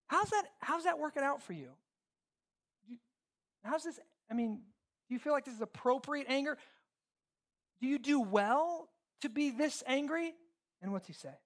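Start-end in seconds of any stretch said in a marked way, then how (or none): 0.1-6.6 higher-pitched, hesitant, pointed questioning; probing questions. Acted. People around someone questioning their anger, probing. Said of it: "they're asking you questions like this". 7.8-10.3 slow, high-pitched challenge; pointed questioning. Acted. God asks Jonah about his anger, pointed questioning.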